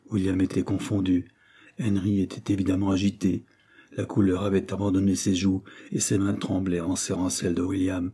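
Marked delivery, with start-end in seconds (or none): none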